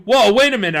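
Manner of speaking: high loud "Whoa, wait a minute"; urgent interruption. Acted. Boy halting friends mid-count, urgent loud interruption. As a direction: cut them off sharply. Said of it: "I interrupted".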